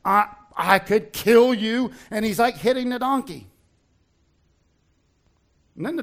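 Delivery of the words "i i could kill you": furious threat. Retold or imitated. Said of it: "And Balaam's like".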